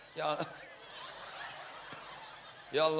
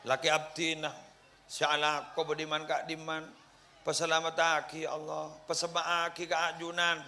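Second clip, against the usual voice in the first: steady, earnest prayer voice, mildly performed; 3 semitones higher than usual. Acted. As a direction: lead the family prayer like an imam, lightly acted. Leads a demo prayer.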